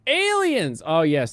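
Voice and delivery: high, loud burst of excitement; excited shout. Real, not acted. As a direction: yell it out like big news. Excited announcement of topic.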